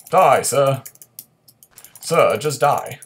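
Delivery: loud, slow die sir; exasperated loud plea; impatient shout. Real, not acted. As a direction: demand the enemy die. Fighting enemy, impatient.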